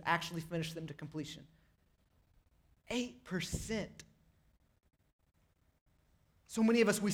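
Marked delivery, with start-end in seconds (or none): 2.9-4.0 soft and very drawn out; slow, hushed emphasis. Real, not acted. Preacher repeats the resolution statistic, slow and hushed. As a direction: let the figure sink in, slowly and softly.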